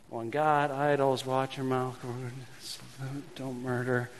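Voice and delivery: normal talking voice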